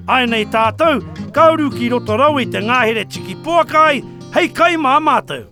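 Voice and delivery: usual speaking voice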